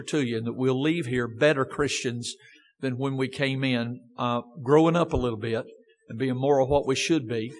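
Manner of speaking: low, soft, earnest prayer. Acted. Preacher praying over the congregation, low and soft. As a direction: pray it low and hushed. Closing prayer, lower and softer.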